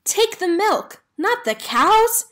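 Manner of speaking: high and loud, calling after someone; yelling. Acted. Country Carl shouting after Hank to fix the mix-up, loud. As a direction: shout the correction across the distance. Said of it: "yelled Country Carl".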